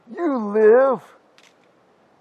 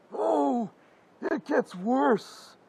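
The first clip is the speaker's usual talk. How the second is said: ordinary talk, nothing marked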